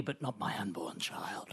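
hushed, whispered mock solemnity; mock noble whisper. Mocking Barnaby's noble plea, whispered. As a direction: drop to a whisper like a wounded noble man. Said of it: "the noble guy".

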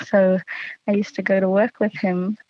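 ordinary talk

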